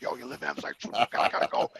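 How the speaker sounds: hushed, very low, half-whispered mock tip-off; furtive prison-scene messenger. Janitor slipping a patient news like prison, furtive and hushed. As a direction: imitate a convict secretly passing word along. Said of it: "it was like a prison scene".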